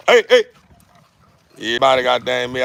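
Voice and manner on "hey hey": high loud hey. Real, not acted. Man hyping up his dogs in the yard, high shout. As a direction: shout it up high to hype them.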